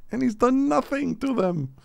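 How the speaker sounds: high exasperated laughing rant; incredulous. Real, not acted. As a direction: rant in amazed disbelief.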